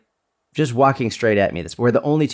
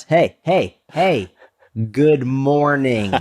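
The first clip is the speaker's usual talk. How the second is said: higher, drawn-out friendly hello; cheery greeting; 4 semitones higher, much slower than usual. Acted. Greeting people to socialize, cheery and drawn out. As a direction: stretch it into a singsong good morning.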